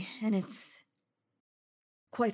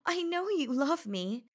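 light laugh before the line, higher; laughing insistence; 5 semitones higher than usual. Acted. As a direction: chuckle it, sure of their love.

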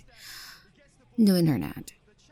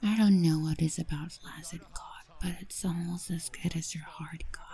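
whispering; 5 dB quieter than usual. Acted. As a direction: whisper it close to the ear. Whispered intimate roleplay.

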